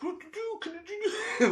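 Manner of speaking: very high, soft frightened squeak; tiny terrified falsetto whimper; mock panic squeak. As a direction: squeak it like a scared kid hiding. Panicking kid alone in truck.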